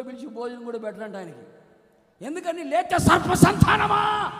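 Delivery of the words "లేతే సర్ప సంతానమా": slow, high, loud condemnation; angry rebuke. Retold or imitated. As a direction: hurl it like a furious prophet. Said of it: "అంటాడు".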